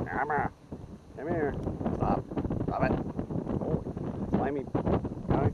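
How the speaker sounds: loud, urgent scolding of a squirming fish; real loud scolding of a wriggling fish; wrestling a fish. Real, not acted. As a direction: grab it while it wriggles.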